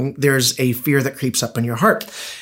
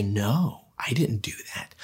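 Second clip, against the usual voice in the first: a small breathy whispered "no, I didn't"; whispered denial; 4 semitones lower, 11 dB quieter than usual. Retold or imitated. Imitating little Lauren whispering her denial to her parent. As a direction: hush it like a guilty kid hiding something.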